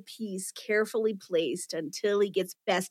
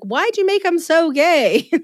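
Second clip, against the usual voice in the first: incredulous interviewers; 8 semitones higher, 6 dB louder, slower than usual. Retold or imitated. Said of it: "people were like".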